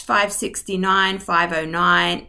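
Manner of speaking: ordinary talk, nothing marked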